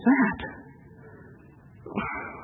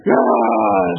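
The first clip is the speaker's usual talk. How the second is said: ordinary delivery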